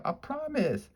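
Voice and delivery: very high, soft sweet promise; pigtail promising. A narrator's reading. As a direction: coo it like a tiny sprite. Pigtail reassuring Paul.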